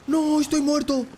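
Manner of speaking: very high, loud screaming; anguished scream. Real, not acted. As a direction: scream in despair as you die. Character dies.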